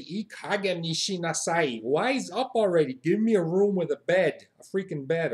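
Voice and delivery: mock-irate hotel guest, pitch lifting; emphatic, exasperated complaint; indignant demand. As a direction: tell off the front desk. Scolding front desk for bed.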